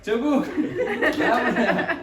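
high, loud shouts half laughing; calling for help. Real, not acted. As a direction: shout urgently for your helper to run over. Calls assistant for help, cat attacking. (gülerek telaşla yardım çağırma)

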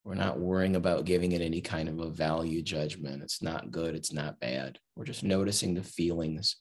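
unhurried body-scan guidance with long pauses; guided meditation. Acted. Teacher guiding listeners to relax hand and leg, guided meditation. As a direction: lead them gently through relaxing, phrase by phrase.

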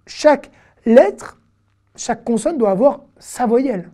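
usual speaking voice

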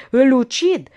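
loud first vow; vengeful vow. Acted. Olga vowing to kill her lover, loud. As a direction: spit the threat.

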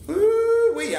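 high falsetto ooh-wee; excited ooh-wee. Real, not acted. Cowboy delighting in the wood smell, high ooh-wee.

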